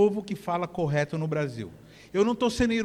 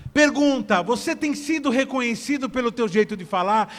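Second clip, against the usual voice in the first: raised, emphatic sermon voice; preaching; 4 semitones higher, 5 dB louder than usual. Real, not acted. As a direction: press the challenge on the congregation, loud and high. Preaching to congregation.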